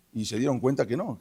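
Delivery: plain reading voice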